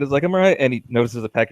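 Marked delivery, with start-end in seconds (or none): 0.2-0.6 flustered insisting. Acted. Kumba insisting again.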